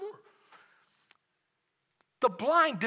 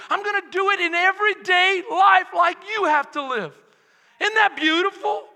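rising faster and louder to a full shout; building to a shout; 8 semitones higher, 6 dB louder than usual. Acted. Preacher building the congregation up, escalating to a shout. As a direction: climb each line until you're shouting.

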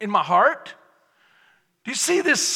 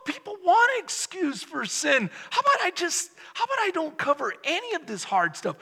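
normal talking voice

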